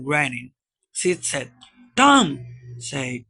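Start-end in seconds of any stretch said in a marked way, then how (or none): 1.9-2.4 loud, high call of a name. Acted. Sid calls to his groaning brother, calling out. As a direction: call out to rouse him.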